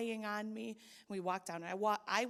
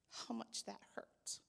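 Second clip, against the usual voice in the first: pained whisper; 2 semitones higher, 8 dB quieter than usual. Real, not acted. Confessing the pain.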